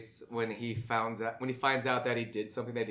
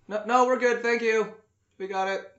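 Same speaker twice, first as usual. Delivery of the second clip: calling to server; 8 semitones higher, 4 dB louder than usual. Real, not acted. Answering someone off the conversation.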